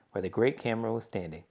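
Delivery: ordinary delivery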